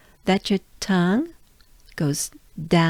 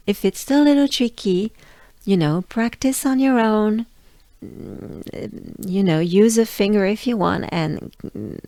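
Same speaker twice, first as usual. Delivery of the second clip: usual speaking voice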